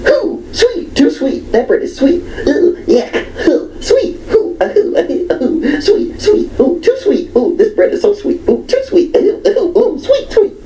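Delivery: very high squealing ooh sweet, laughing through it; shrieking picky woman. Retold or imitated. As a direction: squeal like a twitching fussy diner. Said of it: "jolting up and down and twitching, going".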